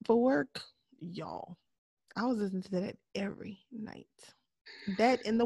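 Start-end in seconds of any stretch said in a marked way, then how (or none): 0.1-1.6 whispered aside. Real, not acted. Dramatic confiding aside.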